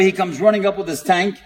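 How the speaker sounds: ordinary delivery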